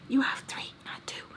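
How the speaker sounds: hushed, whispered aside; whispered correction. Real, not acted. Correcting her about how many kids she has, whispered correction. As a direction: drop to a pointed whisper.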